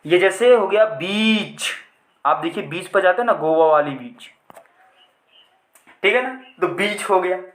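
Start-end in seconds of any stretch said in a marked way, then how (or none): 0.9-1.7 बीच with the ee drawn out slowly; long vowel. Shown as a demonstration. Teacher giving the long sound example, drawn-out ee in बीच. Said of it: "तो ये long sound हो गया".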